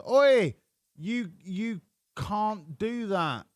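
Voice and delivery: the driver's real words re-done as a mock Australian yell; mock Aussie shout. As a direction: yell it like an outraged Aussie driver, then stretch it. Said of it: "Australian accent".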